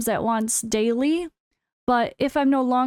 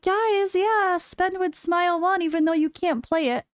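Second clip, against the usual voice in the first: higher-pitched fake promo plea; mock sales pitch; 7 semitones higher than usual. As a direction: put on a higher voice, pitching to viewers. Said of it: "Be like".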